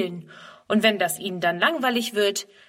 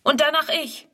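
eager chime-in; 7 semitones higher than usual. A narrator's reading. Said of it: "fällt Friedemann ein".

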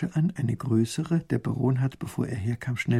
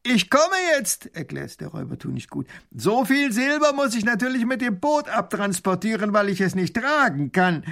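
booming robber voice; 9 semitones higher, 3 dB louder than usual. Acted. Robber taunts the princess across the river, loud and mocking. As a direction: crow over the rich visitors with a big jeering laugh.

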